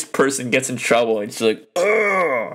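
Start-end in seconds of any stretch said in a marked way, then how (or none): 1.8-2.5 high, drawn-out "ugh"; exasperated groan. Retold or imitated. Viewer hating that the nice person gets blamed, exasperated groan. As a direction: groan in frustration at the unfairness.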